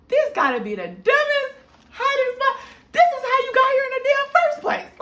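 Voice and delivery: high, loud, exasperated scolding of a roommate; mock scolding. Muttering to herself at the absent roommate, high exasperated scolding. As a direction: tear into her like a fed-up big sister.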